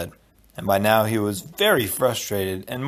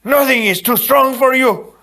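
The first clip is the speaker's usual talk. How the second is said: rallying shout; 12 semitones higher, 7 dB louder than usual. Acted. Inigo urges Fezzik.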